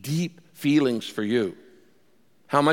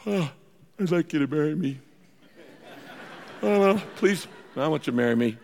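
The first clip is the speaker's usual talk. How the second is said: passionless proposal; 4 dB quieter than usual. Acted. Guy proposing to future spouse on a date, passionless. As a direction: propose with hardly any passion. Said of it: "can't say it with a little bit more passion".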